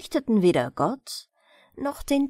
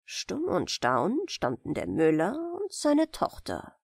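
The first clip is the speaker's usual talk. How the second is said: usual speaking voice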